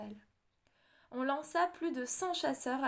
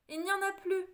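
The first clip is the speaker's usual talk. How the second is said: king's retort; 7 semitones higher, 4 dB louder than usual. A narrator's reading. King scolding queen.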